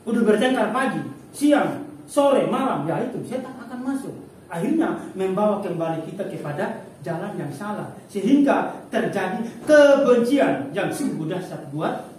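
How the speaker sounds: loud, high fervent sermon rising to a cry; preaching at full force. Acted. Preacher warning congregation that quarrels let Satan in, fervent full-force preaching. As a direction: drive the warning home with fire.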